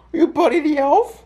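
gasp, then very high delighted squeal; high, playful mock amazement; excited pet talk. Gasping, excited talk to dog.

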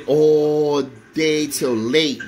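"All day" stretched out very slowly; exasperated emphasis. Real, not acted. Telling how she never called, "All day" drawn out in exasperation. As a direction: drag out "All day" to stress the endless wait.

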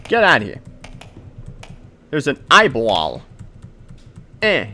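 usual speaking voice

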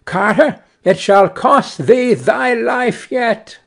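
sparrow mocks the ruined carter; taunting sparrow. A narrator's reading. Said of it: "and cried".

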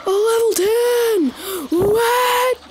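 high, drawn-out disbelieving wail; shocked outcry. Real, not acted. Player sees the opponent is level ten, shocked wail. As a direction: stretch it out in high-pitched disbelief.

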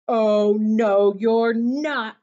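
very loud, drawn-out refusal; troll's roar. A narrator's reading. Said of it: "roared the troll".

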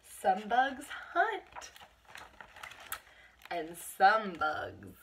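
slow, loud, punchy read-aloud; storybook read-aloud voice, every word punched; reading to children. Acted. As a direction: read each line slowly with big emphasis for little kids. Reads picture book to children.

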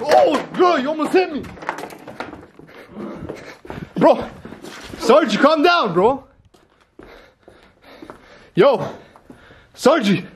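high, tense calls to calm down; scared pleading. Real, not acted. Nearly hit, begs Sargi to calm down, scared high calls. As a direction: keep him back, voice up and shaken.

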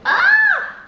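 very high, loud excited scream; excited squeal. Real, not acted. Excited start of mukbang.

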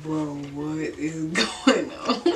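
slow, laughing through the words; giggly high. Retold or imitated. High teen to friends, lost and giggling, slow laughing. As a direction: giggle out the confusion, drawn slow. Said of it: "And I'm just laughing".

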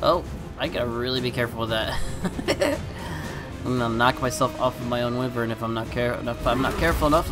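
normal talking voice

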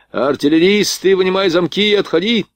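high, ringing command; urgent order. A narrator's reading. Lieutenant warns gunners they are surrounded, urgent shouted order. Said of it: "приказал подошедший пехотный поручик".

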